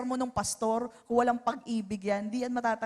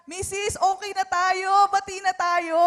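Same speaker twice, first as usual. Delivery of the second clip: louder, higher-pitched make-up appeal; upbeat peace offer; 7 semitones higher, 4 dB louder than usual. Acted. Husband to plate-throwing wife, upbeat peace offer. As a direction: call out a truce to an upset wife.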